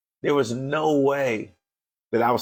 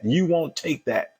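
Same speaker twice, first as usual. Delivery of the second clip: usual speaking voice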